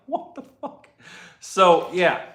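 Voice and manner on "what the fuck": high, stunned disbelief; pitched-up shocked blurt; incredulous. Retold or imitated. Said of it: "I'm like".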